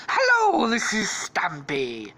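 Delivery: high loud hello, then slow drawn-out introduction; Stampy. Acted. Character popping out of a bush, exaggerated cartoon greeting. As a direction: burst out high, then drop and stretch the name.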